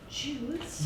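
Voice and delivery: quiet, cautious single word; hushed mention. Acted. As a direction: softly drop the word. Quietly dropping the provoking word.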